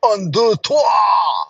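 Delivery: hammed-up French counting. Shown as a demonstration. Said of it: "I can count to three".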